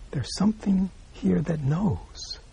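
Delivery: slow, hushed whisper; whispered revelation. Real, not acted. Teacher whispers the key insight to his students. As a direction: whisper it like a secret. Teacher points to inner knowing.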